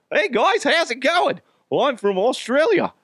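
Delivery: high, hammed-up Australian accent; botched British accent turning Australian. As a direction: attempt British and land on Australian. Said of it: "a British accent".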